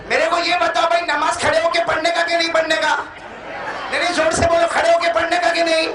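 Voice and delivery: high, ringing call to the crowd; full-force preaching. Acted. Demands answer from crowd. (जोशीली तक़रीर, ऊँची आवाज़ में)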